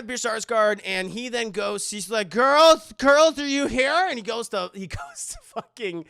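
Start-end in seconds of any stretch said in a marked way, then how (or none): 2.3-4.1 loud, high searching dad; imitated calling out. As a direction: call through the house as the father.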